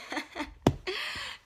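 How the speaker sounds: silly high-pitched laugh like someone tripping; giddy laughter in a high voice; high giggle. Retold or imitated. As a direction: mimic a high goofy giggle. Imitates friend laughing while high.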